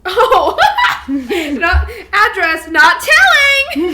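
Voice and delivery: high, loud and slow through laughter; laughing shout. Real, not acted. Friend shrieking with laughter at the old journal joke. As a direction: cackle out the punchline.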